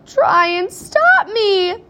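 high, loud, drawn-out retort; defiant yell. A narrator's reading. Queasy mother retorts to Wonka, loud and defiant. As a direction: wail it back in defiance.

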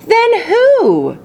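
put-on boy crying out to his grandfather for the answer; eager cry. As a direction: burst out with it, desperate to know. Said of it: "cried Charlie".

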